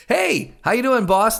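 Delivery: high, bright fake-friendly hello to the boss; fake cheery greeting. Worker pretending nothing happened, greeting the boss in a high cheery voice. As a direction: sing out a falsely breezy greeting.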